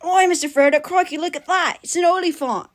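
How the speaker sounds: high mock Crocodile Hunter voice; Australian Sam. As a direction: marvel like an Aussie wildlife host, hammed up. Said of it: "Sam doing the Crocodile Hunter voice".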